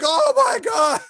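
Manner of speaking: very high, shocked exclamation; horrified at the window; shocked gasp. Retold or imitated. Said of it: "The guy's looking out the window like".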